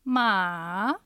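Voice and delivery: ま dipping like a disappointed sigh; dip-and-rise third tone. Shown as a demonstration. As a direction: drop ま low then lift it. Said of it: "一度下がって上がります".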